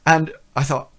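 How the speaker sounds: ordinary talk, nothing marked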